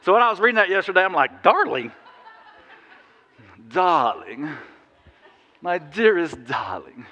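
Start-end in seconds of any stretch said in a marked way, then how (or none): 1.4-4.5 put-on old movie lover. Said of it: "That's where my head went yesterday". 5.6-7.0 slow, lightly voiced old-film crooning; mock smoky-screen romance, drawn out; a hint of a vintage film lover, rising at the end; old movie lover. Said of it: "That's where my head went yesterday".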